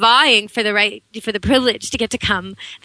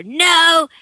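yelled command; 8 semitones higher, 4 dB louder than usual. Shown as a demonstration. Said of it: "you yell".

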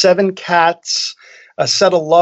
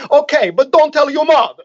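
put-on Italian accent; 11 semitones higher than usual. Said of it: "He would say".

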